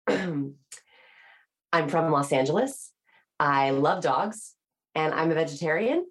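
each statement tilted up like a question; question inflection. Shown as a demonstration. Demoing a liar's rising tone for the host. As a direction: make every claim sound unsure, rising. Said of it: "two truths and a lie would sound like this".

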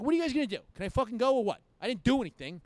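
loud, high, impatient demands; retold angry protest. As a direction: replay challenging the cops, fed up. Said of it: "I was getting mad".

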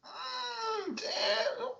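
high, breathy leering moan. Retold or imitated. Pastor mocking a leering congregant with a creepy high moan. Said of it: "Look out into the congregation and be like".

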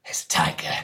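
hushed paranoid warning; urgent whisper. Acted. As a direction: warn them under your breath.

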